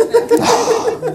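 very high laughing oh; mock shock breaking into a laugh; squealed laughing oh.

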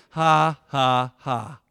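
mock scornful laugh at the enemy; Kenneth Hagin laugh. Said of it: "He would say".

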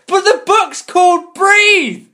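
high and drawn out with comic exasperation; mock outrage. Real, not acted. Mocking the book's title to co-hosts, high and slow. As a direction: squeal the title in disbelief.

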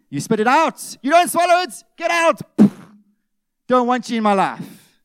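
loud, high shout at anger; fierce rejection. Pretend. Preacher shows how to drive anger out, shouting loud and high. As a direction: spit the anger out with a roar.

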